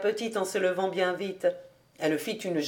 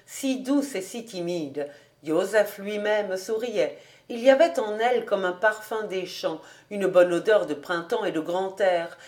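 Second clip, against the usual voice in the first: ordinary delivery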